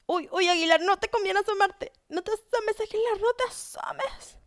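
high, rising pleas to stay hidden; urgent high-pitched warning to a player; anxious warning. Real, not acted. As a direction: plead nervously, pitch climbing. Urging player not to peek.

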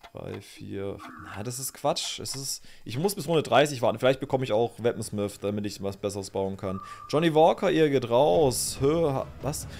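ordinary delivery

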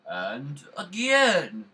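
slow, loud stretched announcement; drawn-out tease. Pretend. Gamer teasing viewers, slow and loud. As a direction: stretch out and again.

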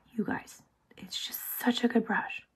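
secretive whisper to the viewers. Real, not acted. Aside, suddenly hushed to viewers.

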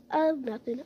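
ordinary talk, nothing marked

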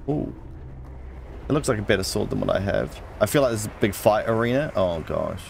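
usual speaking voice

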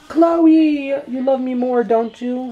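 high cooing voice to a pet. Real, not acted. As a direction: coo at the dog. Baby talk to pet.

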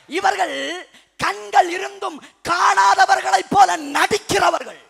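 slow, high, thundering declaration; forceful preaching. Real, not acted. Preacher denouncing those who act blind, in a slow shout. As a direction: hammer out each phrase loudly.

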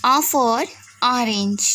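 "O for orange" very slow, each word drawn out; slow phonics drill. Shown as a demonstration. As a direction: spell it out slowly for small children. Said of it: "तो sound किसकी निकल रही है". (धीमे-धीमे बच्चों को सिखाते हुए)

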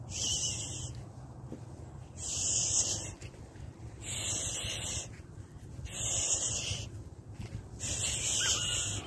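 soft, long shush. Real, not acted. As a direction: hush a frightened horse. Hushing a scared horse.

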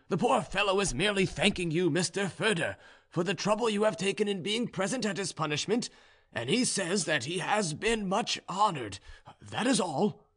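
high serf voice smoothing things over; Ivan, placating serf. A narrator's reading. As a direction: pitch it up, explain it away to the officer.

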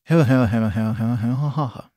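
acted maniacal cackle; repeated put-on mad-scientist ha-ha; mad scientist laugh. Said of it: "Mad Scientist".

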